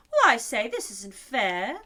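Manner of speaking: loud high outburst slowing down; unicorn cries out. A narrator's reading. Unicorn crying unfair over cake, loud. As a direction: protest indignantly. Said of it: "cried the unicorn".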